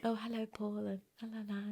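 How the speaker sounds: tiny, high, very soft voice; former timid voice. Shown as a demonstration. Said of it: "I used to talk like that".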